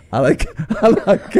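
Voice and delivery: laughing imitation of an English accent; mock English accent. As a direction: imitate her English accent through giggles. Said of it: "they put on English accents".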